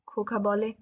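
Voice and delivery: plain reading voice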